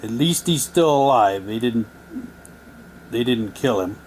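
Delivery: ordinary talk, nothing marked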